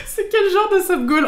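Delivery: high, loud, breaking out of laughter; laughing disbelief. Real, not acted. Streamer reacting to a chat message, laughing in disbelief. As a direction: ask it incredulously through a laugh.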